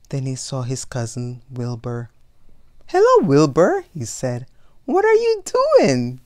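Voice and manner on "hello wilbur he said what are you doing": high, loud cheerful hello; bright greeting. Acted. Elmer cheerfully greets his cousin Wilbur. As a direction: greet him much higher and louder.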